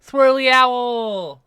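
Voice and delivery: high, slow delighted cry; excited. Real, not acted. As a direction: stretch it out with delight. Delighted at drawing.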